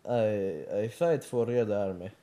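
earnest put-on Russian accent; a devoted patriot in a Russian accent. Vladimir pledging to die for motherland, Russian accent.

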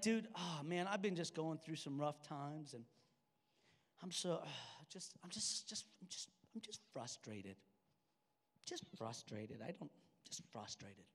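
low, very soft, halting mumble; muted frustration. Retold or imitated. Confiding in his best friend, subdued and frustrated. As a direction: act out worn-down frustration, barely voiced.

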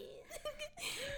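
squealing with laughter; high laughing fit. Real, not acted. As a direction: laugh till near tears. Laughs hard.

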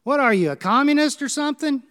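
loud, high jeering shout; a heckler's hammed-up yell from the town square. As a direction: throw the accusation from the back of the crowd. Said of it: "a heckler yelled out".

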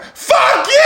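imitating a drunk friend's high, drawn-out holler; drunk yell. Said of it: "being the drunk one he is".

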